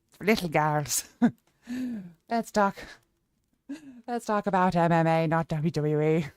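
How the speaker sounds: put-on Irish lilt; Irish accent. Streamer telling chat her views on wrestling, Irish accent. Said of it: "Let's go Irish".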